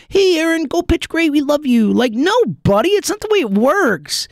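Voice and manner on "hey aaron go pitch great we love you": mock cheerful fans. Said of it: "mock fan cheering".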